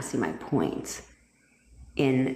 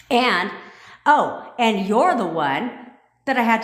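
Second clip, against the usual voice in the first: excited callout; 6 semitones higher, 4 dB louder than usual. Real, not acted. Spots a viewer, gets excited.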